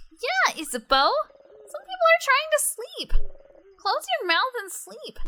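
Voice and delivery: very high, loud mocking parrot; squeaky mock echo. A teasing echo of Odile's scolding, squeaky and high.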